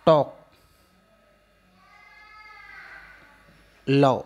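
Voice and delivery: कॉल, वॉल, टॉल with a long held aw; long aw sound; long aw vowel. Shown as a demonstration. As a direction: stretch the aw in कॉल and बॉल. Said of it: "इस sound को आपको थोड़ा सा longer लेना है".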